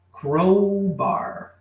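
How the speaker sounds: Crowbar said slowly, falling at the end; teacher models the word Crowbar for students; English model word. Shown as a demonstration. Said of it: "A pronúncia dessa ferramenta é".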